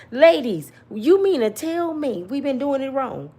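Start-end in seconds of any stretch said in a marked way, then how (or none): none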